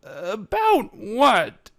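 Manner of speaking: high, slow, hesitant mare voice; a caught mare stalling for time; nervous. Acted. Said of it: "she asked nervously".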